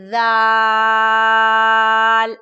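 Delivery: ذال with tongue tip at upper teeth, drawn out loud; soft dhal, held long. Shown as a demonstration. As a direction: stretch ذال slowly and softly. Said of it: "اسے بھی کافی لمبا کر کے پڑھیں گے".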